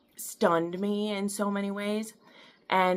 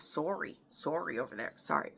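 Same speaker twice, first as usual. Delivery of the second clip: put-on playful Canadian accent on "sorry", said like "soary" with a rounded o; a light joking Canadian "sorry", open o like "sore-y"; 7 dB quieter than usual. Apologizing to viewers for getting distracted, in a jokey Canadian accent. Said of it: "been watching too much Canadian T V".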